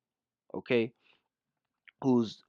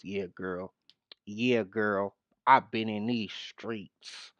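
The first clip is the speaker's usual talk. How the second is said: soft, slow mock of her street talk; 4 semitones lower, 4 dB quieter, slower than usual. Commentator parroting the vice president's slang, soft mocking. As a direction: drawl her slang back sarcastically. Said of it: "here she goes".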